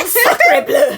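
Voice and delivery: laughing, hammed-up French accent exclamation. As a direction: shout it in a high, comic French accent. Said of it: "you should have a French accent".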